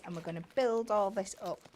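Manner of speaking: ordinary delivery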